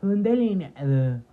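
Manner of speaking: loud, slow proclamation of un delineado; loud eager announcement. Real, not acted. Showing her eyeliner, a loud drawn-out announcement. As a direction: sell it like a big reveal.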